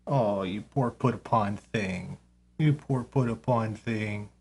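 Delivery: low, soft cooing to the animal; soft pity for the put-upon pet; pitying pet talk. Real, not acted. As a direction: coo at the poor creature in a hushed voice.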